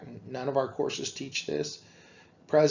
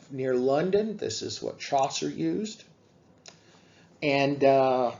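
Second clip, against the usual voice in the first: ordinary talk, nothing marked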